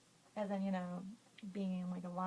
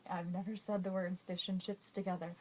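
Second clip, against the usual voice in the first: usual speaking voice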